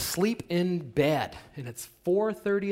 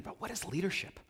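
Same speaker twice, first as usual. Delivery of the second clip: normal talking voice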